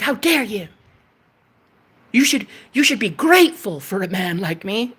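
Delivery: a hint of a smug man; mildly mock an offended suitor; lightly voiced entitled man. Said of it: "'cause you're like".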